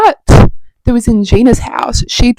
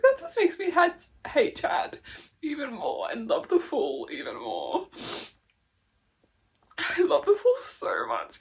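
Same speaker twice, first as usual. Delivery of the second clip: teary; 2 semitones higher, 17 dB quieter than usual. Real, not acted. Keeps talking softly while teary.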